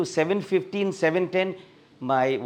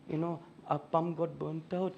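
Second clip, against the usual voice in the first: tearful wife; 10 dB quieter than usual. Retold or imitated. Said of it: "she started sobbing".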